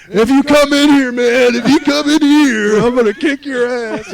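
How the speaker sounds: high loud mock yapping; yapping drunk threat. Drunk in the limo threatening the guard, high yapping. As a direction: yap it like a little dog at a window. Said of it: "like a little dog barking".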